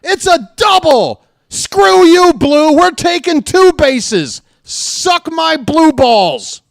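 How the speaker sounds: wild excited shouting over a double; emotional outburst. Real, not acted. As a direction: yell the runner home like your life depends on it. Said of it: "I got a little emotional".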